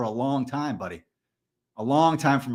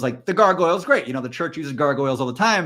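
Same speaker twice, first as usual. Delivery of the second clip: usual speaking voice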